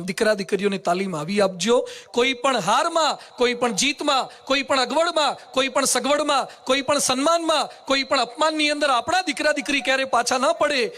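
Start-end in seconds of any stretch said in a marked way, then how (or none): 2.1-10.9 rhetorical build-up. Acted. Rhetorical list, slow and raised. (ધીમી, ઊંચા સ્વરે ગણાવટ)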